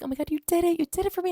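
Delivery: put-on high-pitched girlish amazement; mock gushing. Heroine thanks lover for doing it, mock gushing.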